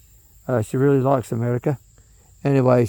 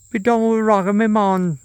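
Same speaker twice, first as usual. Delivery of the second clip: mock Jamaican accent, pitched higher; 8 semitones higher than usual. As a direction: toss back a playful Jamaican patois retort. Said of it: "you're developing a Jamaican accent".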